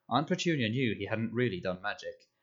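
plain reading voice

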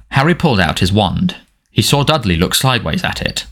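plain reading voice

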